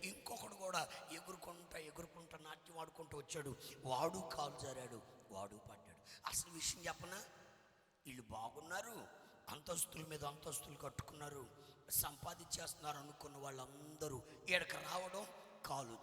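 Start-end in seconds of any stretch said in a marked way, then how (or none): none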